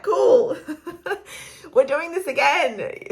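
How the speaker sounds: high, laughing mock cheer, slow at the end; sarcastic resignation. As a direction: laugh it off with fake enthusiasm. Sarcastic at sleepless nights.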